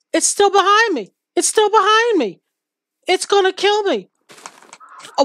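high, loud panicked pleading; frantic, running for her life; scared horror game streamer. Real, not acted. Fleeing the mob, panicking.

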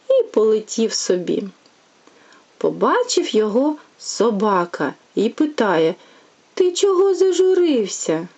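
lively, sing-song bedtime tale reading; storytelling to a child. A narrator's reading. Mother reading a fairy tale to her little one, sing-song. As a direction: read it like a fairy tale for a toddler.

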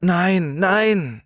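put-on a scared child recoiling from the hole; startled refusal. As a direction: push it away in fright. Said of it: "wehrte scheu und erschrocken ab".

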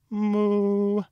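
long, loud, high-pitched moo; high cow moo. A narrator's reading. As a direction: artistic lowing, held on one high note. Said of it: "whistled the cow".

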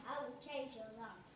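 low, soft robot maid voice; polite butler robot. Acted. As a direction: speak like a calm obedient robot. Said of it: "the all-new Robot Maid three thousand".

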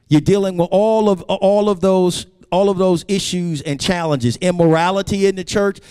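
high, ringing sermon delivery; emphatic preaching. Real, not acted. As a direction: preach it with rising intensity, pitch held high.

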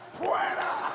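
commanding shout. Retold or imitated. Final expulsion command.

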